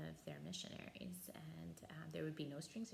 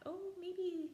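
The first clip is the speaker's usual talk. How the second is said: very high, bright-eyed "oh, maybe"; a thought dawning, voiced way up high; sudden realization; 14 semitones higher, 5 dB louder than usual. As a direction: jump up high like an idea just struck. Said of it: "so I thought".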